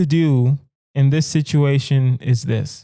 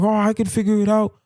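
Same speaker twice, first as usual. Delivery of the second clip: cocky know-it-all, pitched higher, trailing into blah blah; mock tough guy; 7 semitones higher than usual. Passenger mocking the tough guys on the plane, cocky higher voice. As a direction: play the overconfident passenger brushing it off. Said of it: "the first two people are gonna be the tough guys".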